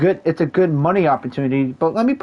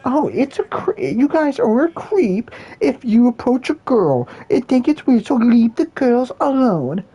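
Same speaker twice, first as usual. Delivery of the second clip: a bit higher, lightly put-on woman; a hint of a preachy girl; mocking woman's voice; 7 semitones higher than usual. Quoting women online.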